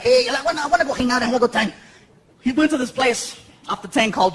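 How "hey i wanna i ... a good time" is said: lightly voiced eager pal; quick patter in a friend's borrowed voice; a hint of a rascally older comic; imitating Paul Rodriguez. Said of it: "and Paul's like".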